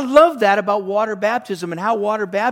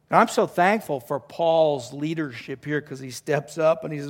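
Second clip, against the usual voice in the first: usual speaking voice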